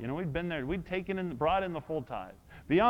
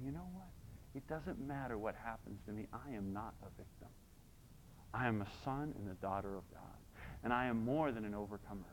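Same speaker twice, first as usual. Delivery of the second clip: quiet confession; 9 semitones lower, 15 dB quieter than usual. Shown as a demonstration. Modelled confession of faith.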